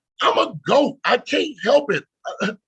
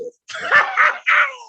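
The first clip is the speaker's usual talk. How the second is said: high, loud shriek of laughter; 4 dB louder than usual. Real, not acted. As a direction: burst into a squealing laugh. Laugh at the joke about love.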